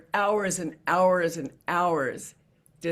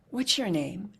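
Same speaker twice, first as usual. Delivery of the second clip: whisper. Real, not acted. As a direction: whisper the question gently. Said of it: "and I asked".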